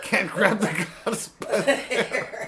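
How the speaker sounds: high, slow words through laughter; laughing. Real, not acted. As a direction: squeeze it out while cracking up. Laughing hard.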